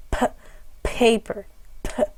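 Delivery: paper with a strongly aspirated p; P, paper, p, each a burst of air; puff of air. Shown as a demonstration. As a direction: pop the P with extra breath. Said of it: "an extra puff of air".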